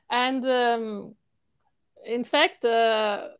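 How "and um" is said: ordinary talk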